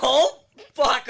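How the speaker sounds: loud, high, real laughter; shocked, laughing swear; laughing outburst. Real, not acted. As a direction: exclaim it while cracking up. Cracking up at confession line.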